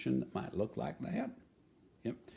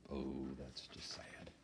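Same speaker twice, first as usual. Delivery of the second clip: softer, slow, mock-sad sigh; 5 semitones lower, 12 dB quieter, slower than usual. Reader sympathizes with sad Billy, slow and soft. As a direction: sound sorry for Billy.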